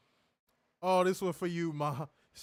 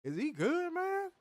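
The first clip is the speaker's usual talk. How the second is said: retold disbelief; 12 semitones higher than usual. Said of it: "I'm like".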